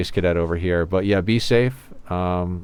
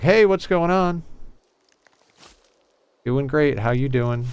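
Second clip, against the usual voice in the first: higher, slower put-on cheer to hide tears; fake cheerful greeting; 8 semitones higher than usual. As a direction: act fine and upbeat for coworkers. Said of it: "but I'm like".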